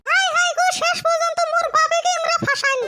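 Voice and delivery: high-pitched scolding wife voice; a wife nagging her husband to go shopping. Acted. Wife scolds husband about the in-law's visit, high and nagging. Wife laments trick on father.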